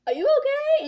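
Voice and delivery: very high, loud squeal of worry; retold frantic concern. Retelling how they checked on injured Kelsey, high frantic squeal. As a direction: blurt it high and loud, imitating your panicked self. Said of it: "I was like".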